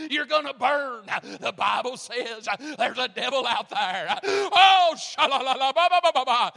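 shouting revival preaching, then a tongues burst; Jimmy Swaggart. Retold or imitated. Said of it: "I started out as Jimmy Swaggart".

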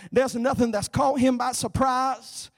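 high, loud, driving sermon delivery; full-force preaching. Real, not acted. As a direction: build to a fervent preacher's shout, then ease.

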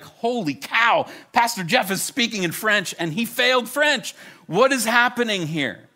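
loud, high-pitched mock astonishment; amazed. [name] astonished pastor speaks French, acted amazement. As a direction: exclaim in hammed-up disbelief.

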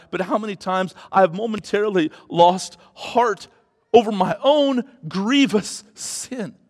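ordinary delivery

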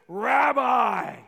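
Rabbi cried out loud in sudden recognition; joyful cry. Acted. Mary recognizing the risen Jesus, a loud joyful cry. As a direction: shout it out as she knows him at last.

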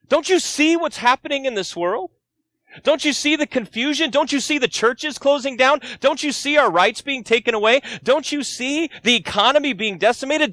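high, pleading cry to Jesus; anguished plea. Acted. Pastor voices the church's question to Jesus, high and pleading. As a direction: cry out as someone who feels abandoned. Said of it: "who's heard that question asked".

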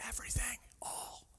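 soft unvoiced whisper. Real, not acted. Preacher whispers the word's meaning to the congregation. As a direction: breathe the words out.

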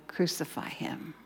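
hushed whisper of the mob cry. Retold or imitated. Preacher hushes the crowd's cry to a whisper. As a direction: whisper it, barely there.

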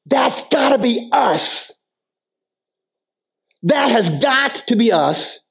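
high, impassioned preaching voice; preaching at full force. Acted. Urging believers to ask others to follow Jesus, impassioned. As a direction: push it out like a pulpit climax.

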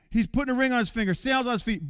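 normal talking voice

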